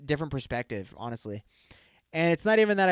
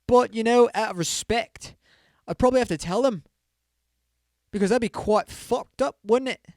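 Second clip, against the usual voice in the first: put-on playful British accent, louder and higher; 8 semitones higher, 6 dB louder than usual. Podcaster answering a listener poll in a playful British accent. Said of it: "as the Americans would say".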